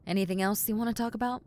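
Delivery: ordinary talk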